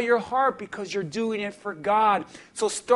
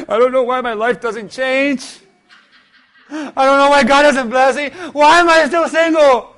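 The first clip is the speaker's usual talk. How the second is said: mock whining; 2 semitones higher, 7 dB louder than usual. Mocking a complaining believer.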